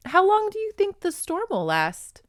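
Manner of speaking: put-on high, hesitant girl's question; timid heroine. Woman asks captor, at a loss, high and hesitant. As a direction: ask it small and unsure, voice lifted high. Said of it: "she asked".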